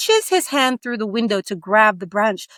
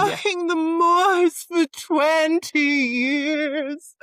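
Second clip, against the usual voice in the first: ghostly wail; 9 semitones higher, much slower than usual. Acted. Ghost girl at the window.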